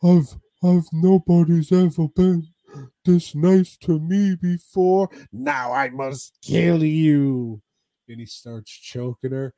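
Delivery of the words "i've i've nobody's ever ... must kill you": higher, louder put-on dopey brute voice, slowing at the end; hammed-up brute thanking her before he kills her; imitating the big guy. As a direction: play the simple giant, touched then menacing. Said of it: "He's like".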